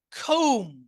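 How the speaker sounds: কম্ব said as "kohm", no b; silent b in comb. Shown as a demonstration. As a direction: drop the final b of কম্ব, ending on m. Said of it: "এই বিটা উচ্চারণ হবে না". (কম্ব-এর শেষের ব অনুচ্চারিত, "কৌম")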